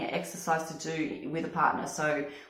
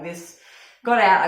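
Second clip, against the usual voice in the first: normal talking voice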